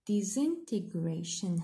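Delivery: "Disintegration" said slowly; teacher counting syllables. Shown as a demonstration. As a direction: sound out "Disintegration". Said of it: "نسمعوا plusieurs".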